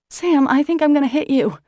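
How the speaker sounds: high, loud calling out. Acted. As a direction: call out in alarm. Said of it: "I call out".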